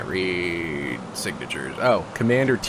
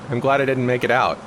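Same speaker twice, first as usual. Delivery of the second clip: normal talking voice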